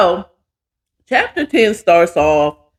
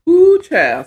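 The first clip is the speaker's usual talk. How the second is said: excited exclaim; 10 semitones higher, 3 dB louder than usual. Real, not acted. Excited start of chapter.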